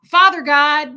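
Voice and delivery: loud, booming imitation of Fred praying; loud prayer. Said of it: "and it was so loud".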